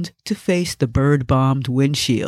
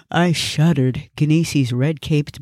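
plain reading voice

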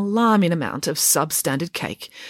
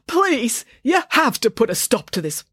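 pleading; 6 semitones higher than usual. A narrator's reading. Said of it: "pleaded the manager".

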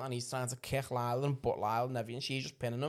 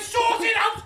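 high, loud order; exasperated yelling; 11 dB louder than usual. Acted. Dad ordering his kids to toughen up, shouting. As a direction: yell the command, voice right up. Re-enacts telling off own kids.

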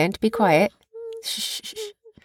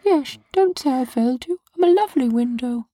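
window voice; 4 semitones higher than usual. Pretend.

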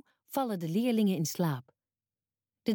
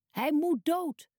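higher, a storybook crowd's mild roar; 7 semitones higher, slower than usual. A narrator's reading. Crowd roars at Pilate for Jesus's death, mild mock roar. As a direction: call it out like an angry mob, kept gentle. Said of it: "brullen de mensen".

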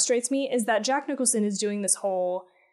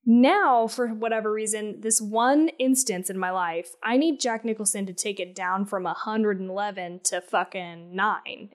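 ordinary talk, nothing marked

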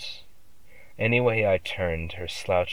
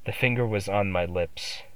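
ordinary delivery

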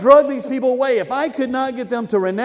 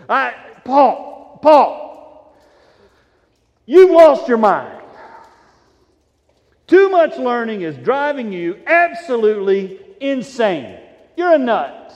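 exasperated outburst; 7 dB louder, slower than usual. Retold or imitated. Festus interrupts Paul in exasperation, loud. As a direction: blow up at him in disbelief. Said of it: "he can't take it anymore".

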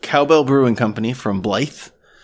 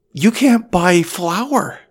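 surprised; 9 semitones higher, 3 dB louder, much slower than usual. Real, not acted. Surprise at empty shelves.

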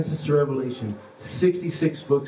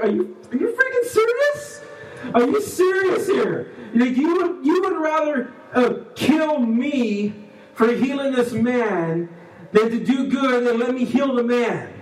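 acted indignant outburst, much higher pitch; ticked-off Jesus; 9 semitones higher than usual. Preacher voices Jesus challenging the Pharisees, upset. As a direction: play him upset and incredulous, stretching the words. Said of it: "He was so upset. He's like".

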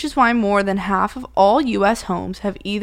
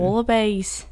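put-on Australian accent; slower than usual. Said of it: "I'm good at that accent".

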